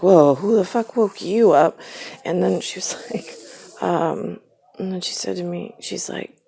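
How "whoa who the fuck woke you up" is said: mocking retort. Retold comeback to mother.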